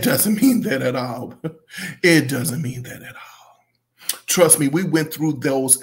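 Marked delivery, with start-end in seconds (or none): 2.0-4.2 slow, fading into a whisper; hushed repeat. Real, not acted. Reviewer telling popular artists the truth, hushed repeat. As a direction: repeat it slowly, letting the voice drop away. Emphatic point repeated in a hush.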